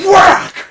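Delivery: sharp, very loud and high punch sound; whack sound effect. Retold or imitated. Fist hitting the desk, a loud high whack. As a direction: smack it out like a sudden hit. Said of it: "I do it with my fist".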